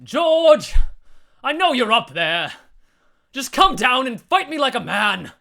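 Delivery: loud, high call up to the roof; yelling. Acted. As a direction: bellow a challenge upward. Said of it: "Panting, he yelled".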